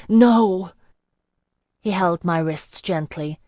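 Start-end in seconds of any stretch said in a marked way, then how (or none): 0.1-0.7 a loud, higher cry of no; desperate plea. Acted. Heroine crying out to stop Hyacinth, loud and desperate. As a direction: beg him not to.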